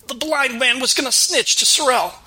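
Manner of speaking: rough thug snapping back; demanding waiter. Acted.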